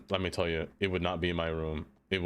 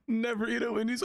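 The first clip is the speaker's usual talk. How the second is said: squeaky high crack of the voice; sudden high-pitched voice crack; 13 semitones higher than usual. Real, not acted. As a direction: voice cracks way up high on "Never eat at Wendy's". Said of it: "my voice just cracked just now".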